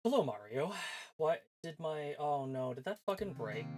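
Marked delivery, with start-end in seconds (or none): none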